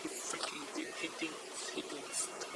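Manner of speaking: almost no voice left as she relives it; whispered. Real, not acted. Reliving the assault to the host, a hushed whisper. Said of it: "whispered grief".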